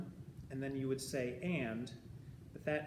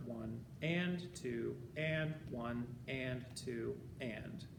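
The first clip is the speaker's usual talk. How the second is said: slow, evenly spaced rhythmic count; counting beats; 3 semitones lower, 4 dB quieter, much slower than usual. Shown as a demonstration. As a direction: alternate "one", "two" and "and" in steady time. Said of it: "this would be the number".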